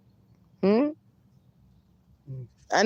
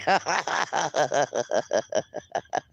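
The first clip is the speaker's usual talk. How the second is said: quiet laugh; 4 semitones lower, 6 dB quieter than usual. Real, not acted. Simple laughing, streamer joins.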